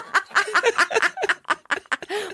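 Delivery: high wheezing belly laugh; corny dad laugh. Real, not acted. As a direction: laugh hard at the bad pun. Reacting to a pun.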